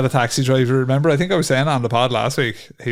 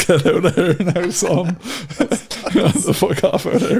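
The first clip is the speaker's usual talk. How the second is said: laughing mock brush-off; 5 semitones higher than usual. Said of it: "I was like".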